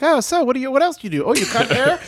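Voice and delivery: mock polite chatter to a stripper; small talk voice. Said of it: "I feel like I have to be like".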